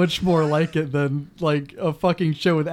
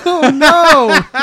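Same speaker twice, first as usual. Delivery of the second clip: laughing; 11 semitones higher, 9 dB louder than usual. Real, not acted. Cracking up at the mistake.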